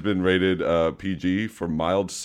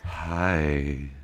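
sexy voice; 4 semitones lower, 3 dB quieter than usual. Pretend. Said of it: "It's my sexy voice".

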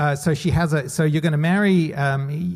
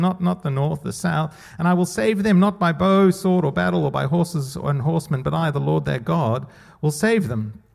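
scripture reading; 3 semitones higher than usual. Acted. Reads God's words from Hosea.

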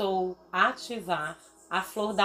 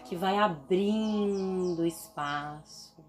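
ordinary talk

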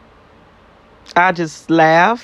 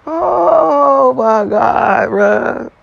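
stunned disbelief; 5 semitones higher, much slower than usual. Real, not acted. Disbelief at crazy story.

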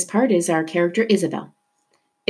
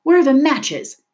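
loud, high urgent cry; 9 semitones higher, 5 dB louder than usual. A narrator's reading. Leto needs to start a fire, crying out. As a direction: call out urgently for help. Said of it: "Leto cried".

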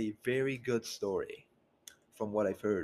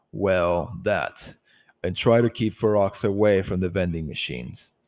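loud wry leader voice; 3 semitones lower, 11 dB louder than usual. Acted. Tenebris jokes before leaving.

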